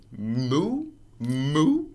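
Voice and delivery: bouncy moos that spring upward; boinging moo. Acted. Reader acts out a boinging cow, bouncy moos.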